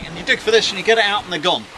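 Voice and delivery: ordinary talk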